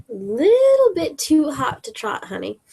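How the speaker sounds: 살짝 놀리는 말투; sassy tease. Acted. Diva teasing in character.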